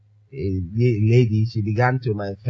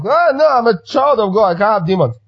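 mocking believer voice; 8 semitones higher, 3 dB louder than usual. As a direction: sneer at the self-righteous believer. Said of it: "And we have".

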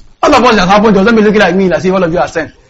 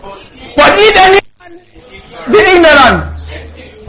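slow, high, drawn-out reading; scripture reading; 7 semitones higher, much slower than usual. Acted. Preacher reading the verse aloud to the church, slow and lifted. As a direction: lift the verse up slowly. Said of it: "Let's read".

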